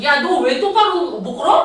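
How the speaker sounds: slow, loud mock scolding of a student; retold loud scolding. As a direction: bark it out slowly like a stern teacher. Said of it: "내가 목소리가 이렇게 크게". (천천히 크게 꾸짖는 흉내)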